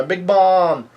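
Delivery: high, drawn-out mock call; retold yell. Somebody yelling to Big Mom, acted high call. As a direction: call the name out, stretching the last word. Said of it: "continues to yell out".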